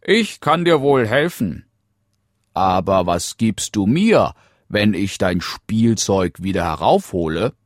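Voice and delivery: loud, lively frog voice; bargaining frog. Acted. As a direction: offer a deal as the frog, projecting. (handelnder Frosch, laut und lebhaft)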